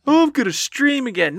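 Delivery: high-pitched mock promise; mocking past self. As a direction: parrot a naive boast up high.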